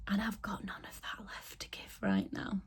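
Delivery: drained, hushed whisper. Real, not acted. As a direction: drop to a breathy whisper.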